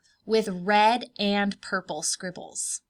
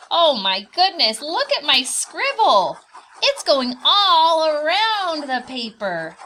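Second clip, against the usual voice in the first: excited delight; 5 semitones higher, 9 dB louder than usual. Acted. Teacher delighted by her scribble.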